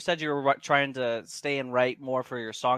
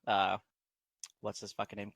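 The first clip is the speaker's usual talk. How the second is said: ordinary talk, nothing marked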